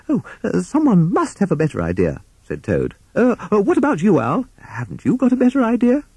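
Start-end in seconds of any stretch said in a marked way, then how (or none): 0.1-2.2 high-pitched, flustered toad; desperate timid Toad. Acted. Toad desperate to escape plan. 3.2-6.0 high, flustered toad appeal; pleading Toad. Acted.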